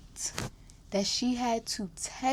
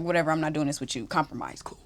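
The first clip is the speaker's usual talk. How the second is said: rattled off low and quick; fast, flat-out done with it; fast dismissive mutter; 6 semitones lower, 5 dB quieter, much faster than usual. Retold or imitated. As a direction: brush it off quickly. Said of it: "I was like".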